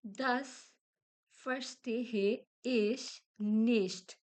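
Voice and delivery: verstehe ich nicht said very slowly; slow model phrase. Shown as a demonstration. Teacher demonstrates phrase for the class. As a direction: give verstehe ich nicht one word at a time. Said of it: "हम लोग कह सकते हैं".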